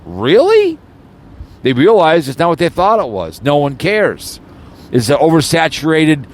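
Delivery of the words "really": incredulous, rising to a high squeak on really; mock the phrase in disbelief. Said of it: "I'm like".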